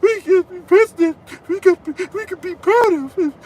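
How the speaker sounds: high, tearful mock sob on every word; mock crying Van Jones. Mocking Van Jones crying on CNN after the election, high fake sobbing. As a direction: ham up a weepy TV pundit. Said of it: "he's like".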